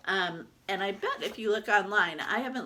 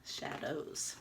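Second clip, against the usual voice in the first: whisper; 6 semitones lower, 12 dB quieter than usual. Shown as a demonstration. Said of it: "See the shadow?".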